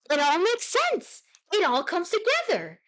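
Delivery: high, exclaimed eureka; excited revelation. Pretend. Streamer to viewers as it all makes sense, hammed-up excited revelation. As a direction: play it as if everything suddenly clicks. Playful revelation after repeating word.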